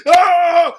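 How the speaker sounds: loud, high raging "aah"; mock scream. Saul raging at the prophet, screaming. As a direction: yell out like a raging madman. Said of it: "He was yelling, screaming".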